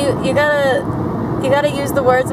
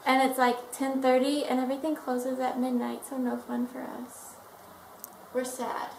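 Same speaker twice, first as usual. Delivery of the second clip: hushed; 13 dB quieter than usual. Real, not acted. Quiet in hotel at night.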